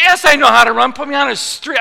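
loud, high indignant comeback; indignant retort. Retold or imitated. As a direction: shout back at the doctor, fed up. Acted retort from the treadmill.

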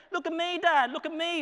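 put-on high-pitched child begging for attention; imitating his kids. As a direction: mimic a kid calling out to Dad. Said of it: "as my kids like to say it".

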